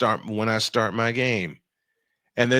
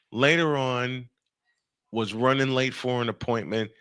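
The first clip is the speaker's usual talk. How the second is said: usual speaking voice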